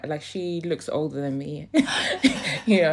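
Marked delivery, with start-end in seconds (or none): 1.7-2.6 short loud burst of laughter; high laugh. Real, not acted. Comparing herself to a celebrity with a short loud laugh. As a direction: let a high laugh pop out.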